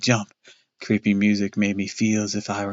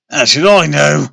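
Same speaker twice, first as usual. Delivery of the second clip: louder, higher angry snap; 5 semitones higher, 8 dB louder than usual. Acted. Father snapping angrily at his wife. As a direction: bite back at her. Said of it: "Dad snapped angrily".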